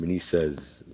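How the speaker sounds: plain reading voice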